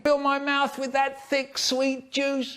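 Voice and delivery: a squeaky, greedy plea for juice; high put-on voice. Quoting things we say.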